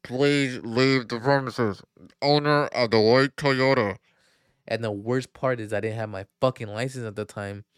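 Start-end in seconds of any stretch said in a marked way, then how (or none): 0.0-4.0 slow, loud tannoy voice ordering the car moved; imitating a security loudspeaker; loudspeaker announcement. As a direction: boom it out like a parking lot PA. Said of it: "I just kept hearing".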